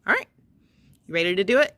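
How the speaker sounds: ordinary talk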